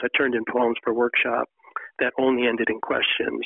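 normal talking voice

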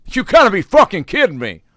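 high, loud exasperated outburst; angry customer. Retold or imitated. Angry customer in line at the Dairy Queen, high and loud. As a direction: blurt it like a fed-up customer. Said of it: "Three guys deep was".

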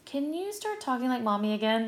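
a hint of a small child's plea; young daughter. Daughter asking mom to drop the accent, lightly imitated. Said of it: "she goes".

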